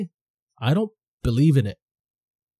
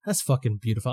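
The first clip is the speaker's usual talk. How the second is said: ordinary delivery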